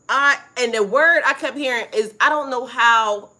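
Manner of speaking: loud, fast and excited, words tumbling; bursting to tell it, can't slow down; excited rush. Real, not acted. Telling the live chat the news, loud and excited. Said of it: "let me slow down, 'cause I know it's rambling, but I'm excited".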